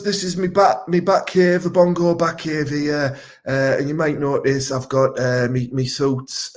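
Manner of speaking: ordinary talk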